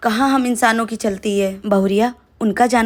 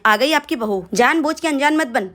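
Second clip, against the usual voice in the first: usual speaking voice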